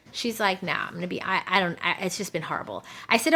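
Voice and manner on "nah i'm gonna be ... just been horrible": low, soft, halting admission; mildly dejected. Retold or imitated. Client admits to coach it hasn't worked, mildly dejected. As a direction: admit defeat quietly, stumbling over the start.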